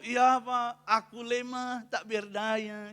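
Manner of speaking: mock-feeble, drawn-out complaint; meniru keluhan lemah. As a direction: imitate a helpless churchgoer, dragging the words. Menirukan ibu yang mengeluh lemah. (keluhan lemah yang dibuat-buat)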